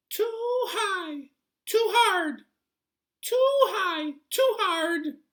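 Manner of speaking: very high, loud squeaky complaint; a fussy little sprite shrieking its gripe; high squeaky goblin whine; tiny creature voice. A narrator's reading. Hudgeon complains about the bed.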